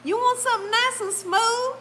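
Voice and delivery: high, singsong coaxing question; sweet-talking stranger. Retold or imitated. Lady talking up the ride within earshot, high coaxing. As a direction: mimic an innocent-sounding sales pitch. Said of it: "That's how she was talking".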